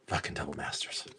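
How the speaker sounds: low, very soft mutter; hushed aside. Real, not acted. Seller marvelling at double masters, muttered quietly. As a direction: say it low, under your breath.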